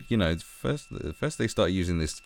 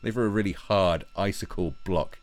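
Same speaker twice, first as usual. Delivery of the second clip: usual speaking voice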